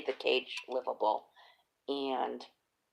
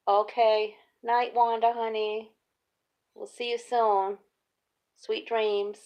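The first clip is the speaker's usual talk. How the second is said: high, louder singsong mom voice; sweet goodbye to son; 6 semitones higher, 3 dB louder, slower than usual. Retold or imitated. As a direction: coo goodbye to a child. Mother seeing son off to school.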